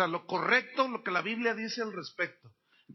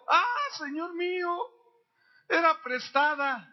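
grito apurado; 7 semitones higher, 5 dB louder than usual. Retold or imitated. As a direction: act out a man crying out in distress. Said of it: "Y gritó diciendo". (grito agudo y angustiado, alargado)

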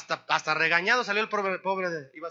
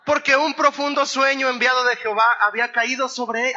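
scripture read with raised voice, a bit louder and higher; preaching scripture aloud; 6 semitones higher, 6 dB louder than usual. Acted. Preacher reading the verse to his congregation, loud and full.

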